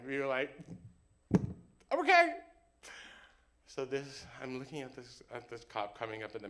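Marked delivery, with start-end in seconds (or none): 1.9-2.3 very high, loud squeak; panicked agreement. Acted. Both reacting in panicked moment.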